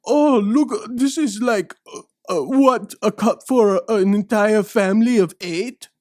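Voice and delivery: a hammed-up French tourist aghast at the cup; mock French snob. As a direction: gasp in disdain at the huge cup. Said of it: "This is what I would do if I was French".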